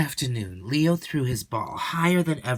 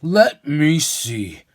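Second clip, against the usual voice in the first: slow pondering; 3 semitones higher, 7 dB louder, much slower than usual. Acted.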